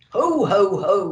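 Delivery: loud jolly Santa laugh; belly-laugh like Santa. A narrator's reading. Santa laughing as they head home, jolly and loud. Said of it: "laughed Santa".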